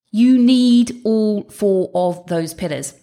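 slow emphatic repeat. Real, not acted. Repeats key point emphatically.

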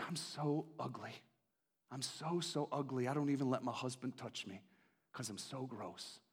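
soft, low dejected voice; hushed, mild imitation of despair; sad imitation. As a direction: mimic her shame quietly. Retelling woman's self-loathing.

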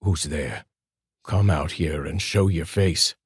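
ordinary delivery

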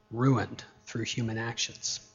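low, soft, sobering echo; hushed repetition. Real, not acted. Preacher repeating the point to congregation, low and soft. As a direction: let the point sink in quietly.

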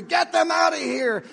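high, loud yell to drive them out; angry mob shout. Acted. Mob at the elementary school chasing out the team, shouting angrily.

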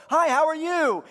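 high, loud friendly hi; cheery greeting. Retold or imitated. Acquaintance greeting you at the store, high and cheery. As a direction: call out a happy hello across the store. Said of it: "and they go".

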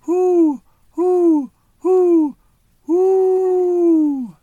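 high, loud owl hoots, last one drawn out. Acted. Owl calls out again, high loud hoots. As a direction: hoot like a calling owl. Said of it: "Owl called".

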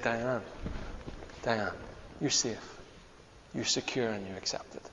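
very soft, hushed and tender; gentle quiet voice. Retold or imitated. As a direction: say it gently, barely above a murmur. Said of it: "He just said".

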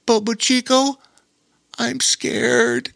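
put-on high-pitched little boy voice, scared; tearful, scared. A narrator's reading. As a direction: play a frightened child in a high voice. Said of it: "Lore's eyes began to well up with tears".

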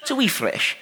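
put-on Uncle Alistair's Scottish accent. Preacher imitates the Scottish uncle at the sheep field, light Scottish accent. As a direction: lightly mimic a Scottish uncle at dawn in the cold. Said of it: "he's in this little sweater".

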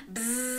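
long, steady buzzing; bee buzz. Shown as a demonstration. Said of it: "Can you be a bee".

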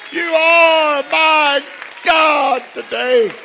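loud, high, fervent preaching cry; full-force preaching. Acted. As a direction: preach it out with all your strength to the church.

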